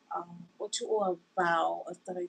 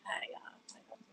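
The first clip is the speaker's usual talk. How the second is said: whisper; 11 dB quieter than usual. Real, not acted. Whispered greeting to viewers.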